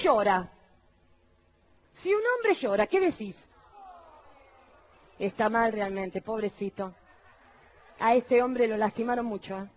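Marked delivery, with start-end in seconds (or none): 5.2-9.7 low, soft pitying coo; mock pity. Pastor mimicking pity for a crying husband, low and soft. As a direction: feign syrupy sympathy for the poor man.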